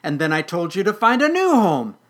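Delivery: loud, high exasperated outburst; very flustered. Acted. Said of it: "The Weedle was very flustered".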